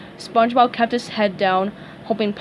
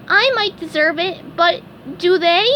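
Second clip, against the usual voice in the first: pleading SpongeBob; 8 semitones higher, 4 dB louder than usual. A narrator's reading.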